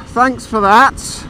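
slow, dry ironic thanks; sarcastic thanks. Pretend. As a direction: thank the cat sarcastically for the mess. Sarcasm at the cat.

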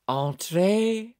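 put-on affected French accent, shouted. A narrator's reading. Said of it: "in an affected French accent".

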